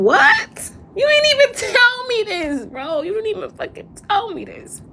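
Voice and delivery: hurt, incredulous pitch leap; stunned disbelief. Real, not acted. Learning what they never told him, in high stunned disbelief. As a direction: voice jumps high on "what" and stays up.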